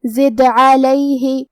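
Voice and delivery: زد with a bouncing, echoed دال, very slow; slow زد علیہ, the sukun دال clicked and released; qalqala on دال. Shown as a demonstration. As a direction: recite زد علیہ slowly, making the دال bounce. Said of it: "ہم حرف دال کو ہلاتے ہوئے".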